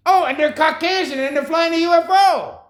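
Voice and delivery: put-on a child pointing at the sky; hammed-up excited child; excited kid. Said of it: "That's what it means".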